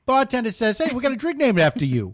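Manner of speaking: high-pitched joking radio host; car radio host. Acted. Car show host telling a caller a joke, high hammy voice. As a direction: play the jokey call-in car show host. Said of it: "This is Click".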